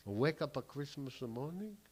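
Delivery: ordinary talk, nothing marked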